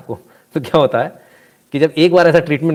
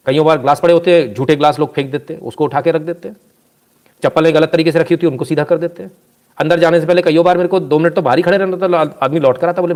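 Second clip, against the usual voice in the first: normal talking voice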